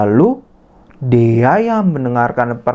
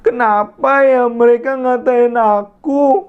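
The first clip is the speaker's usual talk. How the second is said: sad inner thought; 11 semitones higher than usual. Acted. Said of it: "Dia bilang dalam hatinya".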